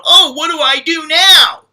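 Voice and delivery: high, loud mock panic. Mocking the panicking overstayer, high loud mock panic. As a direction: squeal it like a freaked-out kid. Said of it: "you're freaking out going".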